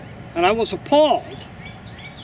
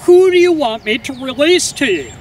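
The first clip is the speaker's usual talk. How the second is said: Pilate addressing the crowd; 5 semitones higher than usual. A narrator's reading. Pilate offering the crowd a prisoner, in a raised public voice. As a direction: put the question out to a whole crowd. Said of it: "he stood up at that point and he said".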